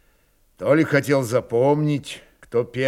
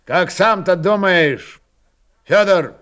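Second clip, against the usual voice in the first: raised, louder voice with an abrupt attack; sharp, loud question; 5 semitones higher, 7 dB louder than usual. A narrator's reading. Chief abruptly asks Fyodor his opinion, sharp and loud. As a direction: fire the question out suddenly. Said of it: "Неожиданно резко и громко он спросил".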